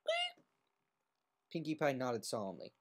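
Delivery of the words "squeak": high rubber-chicken squeak; squeaky toy squeak. Acted. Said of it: "making it squeak".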